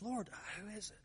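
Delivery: very soft, confidential question; disciple leaning close. Acted. Disciple asking Jesus at the supper, hushed. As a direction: ask it hushed, as if leaning on his chest. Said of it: "he kinda leans back and he says".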